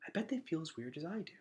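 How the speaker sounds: soft, quick inner voice; inner thought. A narrator's reading. Gregor privately thinking about the shy Underlanders. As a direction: think it to yourself, hushed and fast. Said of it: "he thought".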